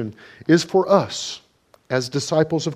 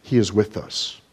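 normal talking voice

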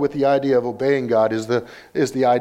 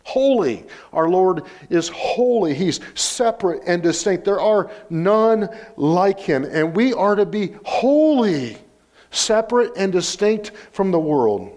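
raised, ringing pulpit delivery; emphatic preaching; 6 semitones higher than usual. Real, not acted. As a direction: proclaim it with high, punched key words.

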